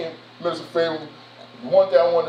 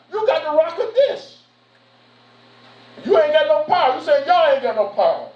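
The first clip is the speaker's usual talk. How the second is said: preaching at full force; 8 semitones higher than usual. Acted. Preacher challenging the congregation, rising fervor. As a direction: drive it home higher and higher.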